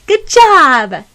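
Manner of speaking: loud, high cheer. Acted. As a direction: cheer her on with delight. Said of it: "Tomeo and Kiro said".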